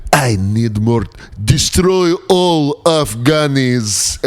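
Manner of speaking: put-on slow, drawn-out Russian accent, rising high; hammed-up Russian bad guy; mock Russian villain. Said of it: "the Russians are like dirty teeth".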